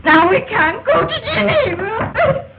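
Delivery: high, slow tearful wail; crying. Acted. Woman upset about losing Geneva trip, crying. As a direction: wail it out through sobs. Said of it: "What are you crying about?".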